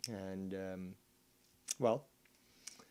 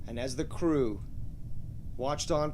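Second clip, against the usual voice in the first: dramatic narrator; 3 semitones higher, 16 dB louder than usual. Acted.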